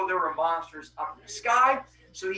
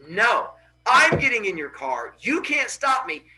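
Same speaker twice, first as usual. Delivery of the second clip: raised, insistent refusal; defiant; 5 semitones higher, 5 dB louder than usual. Acted. Phaethon refuses to wait for his father, defiant. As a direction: push back loudly, pitch climbing. Son defies father, grabs car.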